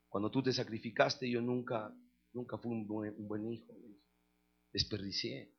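low, soft, halting apology; confesión humilde. Retold or imitated. Hijo confiesa y pide perdón. (confesión arrepentida, en voz baja y grave)

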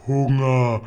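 a loud bellow of hunger, voice pushed away from the narrator's own; roaring giant. A narrator's reading. Hungry giant bellows at little Sophie, a loud roar. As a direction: bellow the single word like a starving giant. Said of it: "brüllte der Riese".

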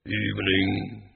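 low, slow voice from underneath; a slow deep greeting from the dark. A narrator's reading. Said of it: "a deep voice from the darkness".

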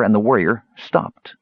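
ordinary delivery, nothing marked

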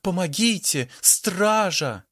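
high cry for help. A narrator's reading. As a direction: call the guards in panic. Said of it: "закричала вампирша".

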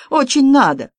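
high, huffy dismissal; indignant. A narrator's reading. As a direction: toss it off in offence. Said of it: "возмутился Добраницкий".

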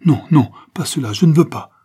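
a high, loud, panicked refusal; horrified cry. A narrator's reading. Morales refuses to become a murderer, crying out in horror. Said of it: "s'écria Morales, devenu blême".

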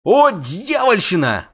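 high, loud and slow; drawn out in awe; amazed exclaim. Acted. Dandelion gasps at the Zerrikanians, slow and high. Bard joins in.

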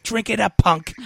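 high, loud mock taunt; Chris Jericho. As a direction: yell it like a wrestling villain forcing a drink. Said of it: "him pouring the booze on C M Punk. He's like".

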